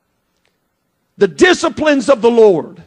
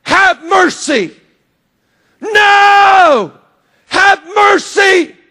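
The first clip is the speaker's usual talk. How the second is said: high, loud pleas, stretched slow on mercy; begging at the top of the voice; desperate shout; 7 semitones higher, 6 dB louder, much slower than usual. Retold or imitated. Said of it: "I just started shouting at him".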